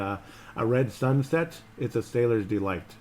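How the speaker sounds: ordinary talk, nothing marked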